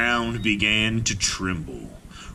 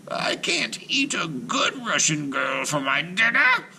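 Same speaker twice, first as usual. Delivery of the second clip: higher whispered witch complaint; 9 semitones higher than usual. A narrator's reading. Witch Baba Yaga grumbles to Marusha, high and whispered. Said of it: "Baba Yaga".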